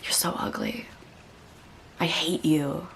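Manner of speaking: whispered inner voice, low and cold; cruel whisper. Retold or imitated. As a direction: hiss the insult to yourself in the mirror. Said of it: "I'd be like".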